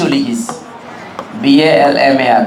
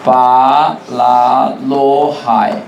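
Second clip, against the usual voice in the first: very slow, lower, drawn-out phrases; slow dictation; 4 semitones lower, much slower than usual. Shown as a demonstration. Teacher giving students a mnemonic to learn, slow dictation. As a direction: dole it out phrase by phrase, stretching words.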